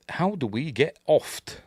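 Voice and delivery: "oft" said with an added final t; slow, puzzled reading that lands on "oft"; misspelling read aloud. Retold or imitated. Host reading a subtitle typo aloud, "oft" pronounced as written. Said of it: "a lot of spelling mistakes in the subtitle".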